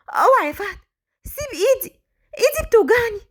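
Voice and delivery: high, pained cry to let go; frightened plea. Acted. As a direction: beg him in fear and pain. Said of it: "وهي خايفة منه".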